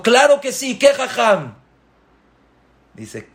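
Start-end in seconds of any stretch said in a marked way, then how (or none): 0.0-1.5 very high, loud eager yes; eager mother. Acted. Mother eagerly agreeing to the sage, very high. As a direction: jump at the offer.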